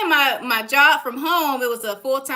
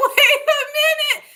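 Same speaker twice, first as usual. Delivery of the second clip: much higher, laughing wait a minute; laughing realization; 13 semitones higher than usual. Retold or imitated. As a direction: laugh through the sudden idea. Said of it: "I said".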